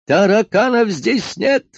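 high, loud, slow wail; yelled. A narrator's reading. Doctor yells from his office, indignant. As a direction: yell it from the next room. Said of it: "завопил из кабинета доктор".

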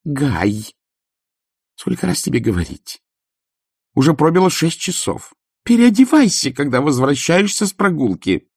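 rising to a high, loud scold; scolding wife. Acted. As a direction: nag him to change his clothes. Baroness scolding husband.

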